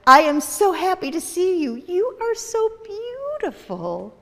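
high, delighted; thrilled. Acted. Vivian greets the dandelion, high and delighted. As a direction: gush with joy, slowing at the end. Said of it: "thrilled right down to her toes".

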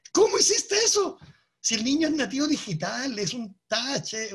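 mock amazement from a teacher watching a kid; amazed teacher. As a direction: gasp it out like he can't believe it. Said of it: "el profe le dice".

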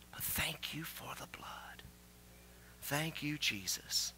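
soft, slow whispered thanks; whispered prayer. Acted. Preacher whispering thanks to Jesus, hushed and slow.

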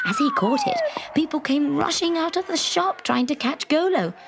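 usual speaking voice

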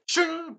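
high ringing imitated shing; teleport sound effect. Teller shows another teleportation, high ringing effect. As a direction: ring it out like a blade-quick vanish.

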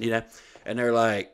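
ordinary talk, nothing marked

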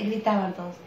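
ordinary delivery

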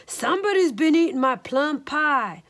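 a hint of outraged papa bear; Papa Honey Bear. A narrator's reading. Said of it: "growled Papa Honey Bear in his great big bear voice".

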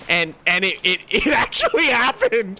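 shocked laughter. Real, not acted. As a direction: giggle through the retelling. Recounting lucky shot, giddy.

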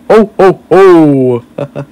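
high, loud Santa ho-ho-ho; Santa laugh. Acted. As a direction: belt out a jolly Santa laugh. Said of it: "I'm good at making Santa laugh".

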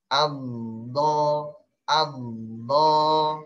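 "Amdo" with the dod stretched long; dod with shadda. Shown as a demonstration. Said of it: "pulang lagi ujung giginya".

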